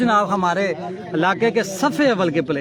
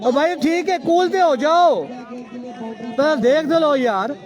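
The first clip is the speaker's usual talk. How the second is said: calling out; 8 semitones higher, much slower than usual. Real, not acted. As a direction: shout across the ground to cool them off. Calming an angry fielder.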